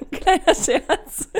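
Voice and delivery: slow, laughing words. Real, not acted. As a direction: say it through laughter. Laughing off own joke.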